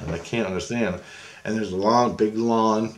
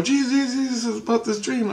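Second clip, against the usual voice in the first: much higher urgent calling out; urgent plea; 13 semitones higher than usual. Retold or imitated. Waking from the dream and calling to Jesus, urgent plea. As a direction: call out for help, shaken.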